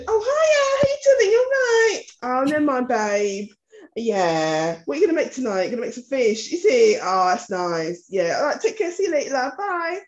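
high, sing-song mock Cockney greeting; put-on Cockney chat. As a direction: switch into a chirpy, matey Cockney. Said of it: "automatically switch to their type of talking".

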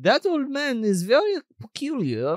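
put-on hammy foreign German-style accent; mock German accent. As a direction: play a suspicious neighbor with a German accent. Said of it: "like, a German accent".

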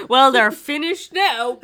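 loud, slow, theatrical huff; dramatic huff. Acted. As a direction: slam the line down dramatically. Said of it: "throws her magazine".

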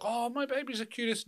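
high-pitched mock gushing; doting parent. Mocking parents who boast about babies, high gushing voice. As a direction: coo it high, mimicking a besotted parent. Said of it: "some people are like".

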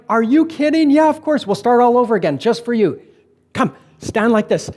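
excited yes, pitched well up; delighted welcome. Retold or imitated. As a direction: imitate someone thrilled to be asked. Retold ladies eagerly welcome him.

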